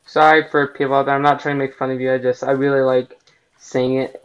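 normal talking voice

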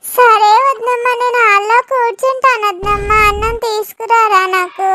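put-on a little boy asking for food; Bintu, childlike. Bintu asks sister-in-law for rice, childlike and pleading.

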